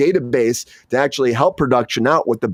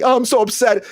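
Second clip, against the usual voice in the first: upset king; 11 semitones higher than usual. Retold or imitated. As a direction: wail for the gasper like a fussy king. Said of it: "the king's like".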